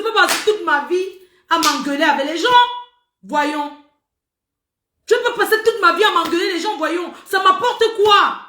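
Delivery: loud, high-pitched outburst of real anger; angry ranting. Real, not acted. Livestreamer telling followers to leave her in peace, angry ranting. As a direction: yell at them, thoroughly fed up. Furious rant at followers, swearing.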